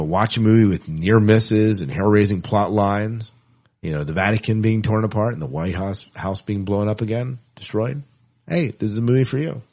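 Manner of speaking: ordinary talk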